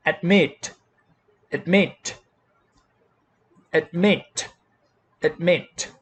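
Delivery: "Admit" with the weight on "mit"; "Admit" said ad-MIT, one word at a time; second-syllable stress. Shown as a demonstration. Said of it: "the second syllable stressed".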